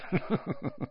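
soft, high chuckle; small self-satisfied chortle; light, quiet titter; little chortle. A narrator's reading. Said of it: "he gave a little chortle".

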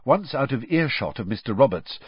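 ordinary delivery, nothing marked

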